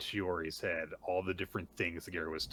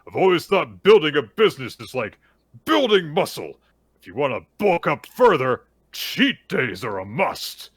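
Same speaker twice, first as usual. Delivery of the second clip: loud, high, hammed-up declaration; booming workout pep talk; 8 semitones higher, 16 dB louder than usual. Man preaching business as workout in a flashback, booming. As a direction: bellow it like a gung-ho muscle coach.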